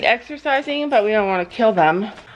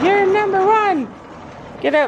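pet talk; 11 semitones higher than usual. Real, not acted. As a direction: cheer the pony on in a high cutesy voice. Praising horse in pet voice.

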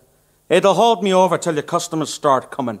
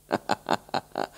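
sly laugh; 10 dB quieter than usual. Acted. Pirelli's menacing laugh.

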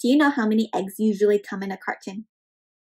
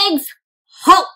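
commanding halt; 4 semitones higher, 6 dB louder than usual. Acted. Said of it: "says shell".

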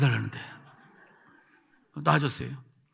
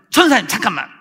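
urgent interjection; 8 dB louder than usual. Retold or imitated. Cutting in on angry evangelist.

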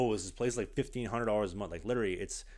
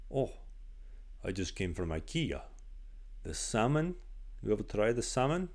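lightly put-on Eastern European accent, rising on salmon; the stern coach gushing about salmon in a slight Eastern European accent; a hint of an Eastern European accent, curious. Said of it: "had a very thick Eastern European accent".

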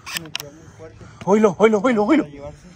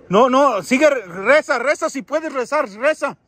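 urgent pleading; 6 semitones higher, slower than usual. Real, not acted. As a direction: call out so they keep going. Urging the child to stay praying. (súplica insistente en voz alta)

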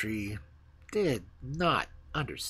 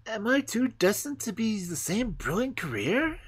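put-on higher, eager young tree voice; excited wondering; 9 semitones higher than usual. The fir tree wonders excitedly about its future, higher. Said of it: "wondered the fir tree excitedly".

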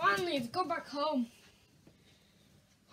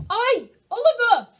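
calling out; 3 semitones higher, 6 dB louder than usual. Acted. Parent calling son.

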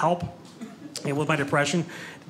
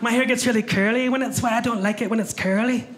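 higher-pitched put-on Scottish woman's voice; Scottish accent; 5 semitones higher than usual. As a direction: play the visitor with a lilting Scottish accent. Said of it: "just flown in from Scotland".

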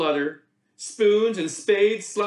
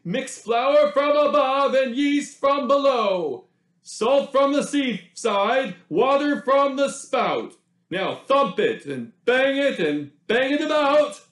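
baker giving orders; 7 semitones higher, 4 dB louder than usual. A narrator's reading. Baker instructing villagers on the dough, loud bossy chant. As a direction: call out the recipe like a proud baker. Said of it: "Bap gave instructions".

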